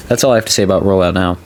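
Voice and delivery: usual speaking voice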